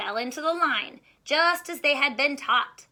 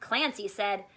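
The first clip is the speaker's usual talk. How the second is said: ordinary delivery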